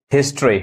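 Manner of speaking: हिस्ट्री in two beats, 'HIS-tree'; taught pronunciation. Shown as a demonstration. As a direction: say हिस्ट्री with no 'o' before 'ree'. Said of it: "री पढ़ा रहा है". (हिस्ट्री को 'हिस-ट्री' बोलें)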